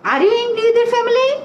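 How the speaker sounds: slow, high question with "family" held up; question intonation. Shown as a demonstration. Teacher recalls the question she asked, high slow questioning tune. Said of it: "প্রথমে কি বলেছি?".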